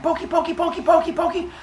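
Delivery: put-on very high little-kid chant; squeaky repetitive toddler singsong; playful baby. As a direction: prod along in a tiny child's voice. Baby prods cows with stick.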